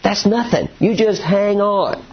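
put-on a father telling his boys to hold on; dad to kids in car. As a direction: fob off the kids from the driver's seat. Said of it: "And to say".

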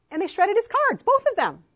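indignant burst over the shredded cards; outraged. Real, not acted. Outraged telling of the bank.

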